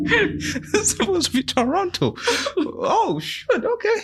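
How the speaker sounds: high, slow, half-crying laugh; a student realising it isn't Toronto; laughing mock despair. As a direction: wail it in jest.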